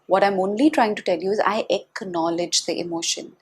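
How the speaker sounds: normal talking voice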